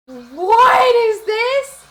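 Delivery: slow, high, loud disbelief; drawn-out disbelief. Real, not acted. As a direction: stretch out the disbelief. Reacting to a chat message.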